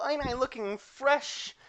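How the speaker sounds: high and slow, showing off; preening girly voice. Acted. Clumsy girl showing off her outfit, slow high preen. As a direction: stretch it out high and pleased.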